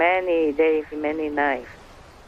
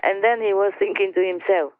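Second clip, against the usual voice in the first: ordinary delivery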